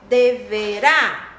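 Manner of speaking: "deverá" slow and loud, landing on RÁ; syllable by syllable "deverá", strong final RÁ; stressed syllable. Shown as a demonstration. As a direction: hit the last syllable of "deverá". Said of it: "tem mais intensidade".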